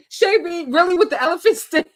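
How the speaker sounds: ordinary talk, nothing marked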